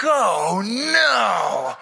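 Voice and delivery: long drawn-out mock dismay; comic dread. Two good old boys see a big man coming, comic dread. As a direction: groan out each word in slow comic horror.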